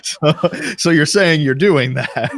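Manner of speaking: slow, high, laughing through the words; drawing it out between laughs; laughing tease. Real, not acted. Teasing repeat of the question.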